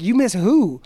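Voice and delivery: loud, high, disbelieving retort; incredulous retort. Retold or imitated. Retelling his reply to the old classmate, loud disbelief. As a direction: throw the question back, half shouting. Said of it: "I was like".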